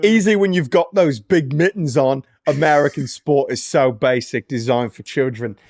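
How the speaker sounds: mock a British sports fan; amateur put-on British accent. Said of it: "in your best British accent".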